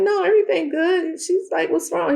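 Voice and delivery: chirpy high put-on phone voice; mock high voice. Melody voiced on phone call.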